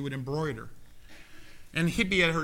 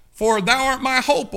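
loud, high cry on hope; fervent pulpit shout; preaching shout; 12 semitones higher, 14 dB louder than usual. Real, not acted. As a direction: proclaim it to the rafters. Preacher quoting psalm, fired up.

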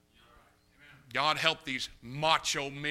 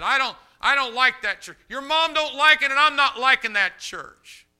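high, loud raging voice; drunken angry shout; 12 semitones higher, 14 dB louder than usual. Retold or imitated. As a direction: bellow it like a furious drunk.

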